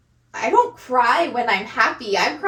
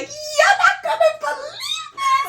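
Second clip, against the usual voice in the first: very high, slow mock wail; imitated laugh-crying; slower than usual. Friend imitating mother's phone call, hysterical laugh-crying. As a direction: squeal it like someone hysterical on the phone. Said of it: "she's still crying and laughing".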